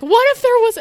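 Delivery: very high, loud mock panic; retold panic. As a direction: squeal the worry in re-enacted alarm. Retold panic after bear.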